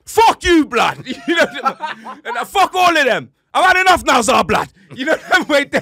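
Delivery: yelling loud and high through laughter; laughing shout. Real, not acted. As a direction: blow up in a laughing rant. Furious rant about Arsenal player.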